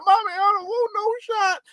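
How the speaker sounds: put-on high, whiny kid pleading with mommy; child at the doctor whining for mommy. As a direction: play a scared little kid begging. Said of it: "you be crying".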